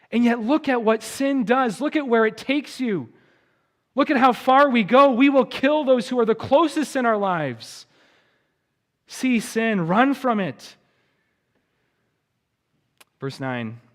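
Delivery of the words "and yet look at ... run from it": higher, louder urgent sermon delivery; impassioned preaching. Real, not acted. Pastor warning congregation how far sin takes them, impassioned. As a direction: drive the warning home from the pulpit.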